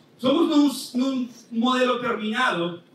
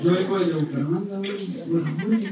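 lower, put-on voice of a fed-up tourist from Bucaramanga; annoyed tourist voice; 4 semitones lower than usual. Tourist complains to the ticket seller, in a lower put-on voice. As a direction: grumble like a man who waited three hours. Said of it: "Y entonces el hombre dice".

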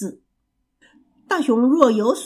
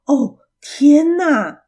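loud, high, drawn-out storybook dismay; shocked exclamation; 5 semitones higher, 7 dB louder, much slower than usual. A narrator's reading. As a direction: exclaim in exaggerated shock, stretching it out. Mouse reacts to dire news. (拖长声音大声惊叹)

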